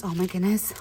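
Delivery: usual speaking voice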